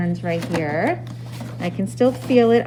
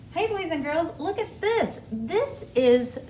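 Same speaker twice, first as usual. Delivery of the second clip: put-on overbright kids party host; 7 semitones higher, 4 dB quieter than usual. Teacher greeting kids on video.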